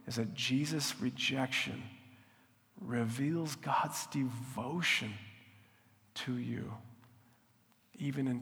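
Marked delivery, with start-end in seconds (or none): none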